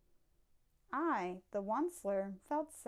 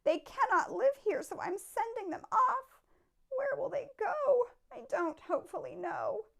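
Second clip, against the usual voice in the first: Lorax, coughing; 9 semitones higher than usual. A narrator's reading. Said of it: "said the Lorax".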